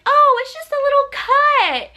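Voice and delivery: high breezy dismissive voice; mock relieved. Brushing off the cut to herself, high and airy. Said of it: "I was like".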